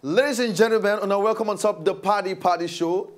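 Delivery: loud, high announcer's call; shouted welcome. Pretend. Co-host shouting to viewers to unfreeze the brain. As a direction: shout the show open like an announcer. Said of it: "you need to shout to unfreeze".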